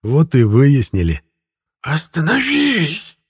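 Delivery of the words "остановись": high, strangled wheeze; drawn-out choking plea; wheezed. A narrator's reading. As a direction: squeeze it out as if being throttled. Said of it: "просипела душа украшения".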